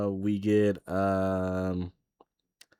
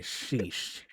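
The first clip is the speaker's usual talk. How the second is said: much softer whispered sheesh; 9 dB quieter than usual. Retold or imitated. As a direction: breathe it out in awe. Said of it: "I'm like".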